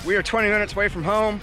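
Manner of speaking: ordinary talk, nothing marked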